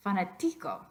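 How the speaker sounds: fanático wrongly stressed fanaTÍco; fanático mis-stressed on its third syllable, TI; stress on second-last syllable. Shown as a demonstration. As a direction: try fanático with the weight on "ti". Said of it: "What about the second to last?".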